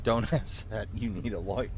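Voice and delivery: chuckling through the words; amused repeat. Real, not acted. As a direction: echo it in your own voice, laughing.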